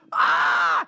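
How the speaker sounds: loud, high mock scream; imitated wordless shout of astonishment; excited yell. Acts out Peter's reaction.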